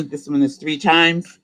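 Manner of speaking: ordinary delivery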